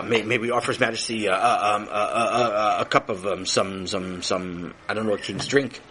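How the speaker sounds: usual speaking voice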